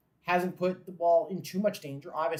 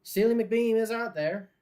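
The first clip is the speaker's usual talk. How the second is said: slow, higher, louder hammed-up Irish lilt; terrible Irish accent; 6 semitones higher, 6 dB louder, slower than usual. Fan joking about the Dublin watch party in a hammed-up Irish accent. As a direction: lay on a bad Irish accent for the mascot. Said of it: "I don't know why I'm talking like this".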